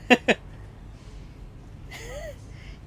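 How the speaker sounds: breathy giggle at own flop; laughing. Real, not acted. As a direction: laugh it off, high and airless. Laughing at own accent attempt.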